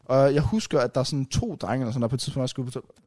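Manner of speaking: usual speaking voice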